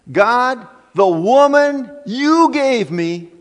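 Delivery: high, loud, drawn-out accusation; accusing blame. Acted. Adam blaming God for the woman, loud accusing protest. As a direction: throw the blame back, slow and emphatic.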